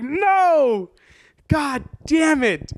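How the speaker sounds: high, drawn-out cry of dismay; acted-out anguished "no"; frustrated wail. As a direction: lament a missed chance, slow and high-pitched. Acts out frustration at missed chance.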